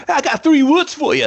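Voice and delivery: mock tough guy delivering his catchphrase; hammed-up tough guy. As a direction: ham up the big threat line. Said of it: "And then the guy's like".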